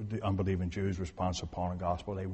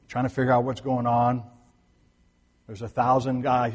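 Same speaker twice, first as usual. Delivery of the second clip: usual speaking voice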